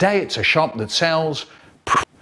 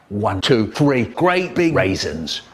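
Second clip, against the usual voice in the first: ordinary talk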